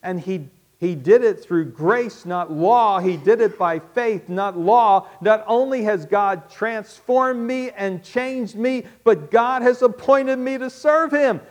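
rising, loud, amazed voicing of Paul; excited imitation of an awestruck Paul; Paul's amazed thoughts. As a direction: build wonder line by line. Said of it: "He's thinking".

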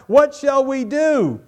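high, loud, slow panicked cry; crying out in fear. Acted. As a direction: wail it like a terrified servant. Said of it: "he cried out".

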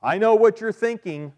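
loud, high-pitched know-it-all claim; mock mind reader. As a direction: announce it like you can read minds. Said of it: "don't be a mind reader".

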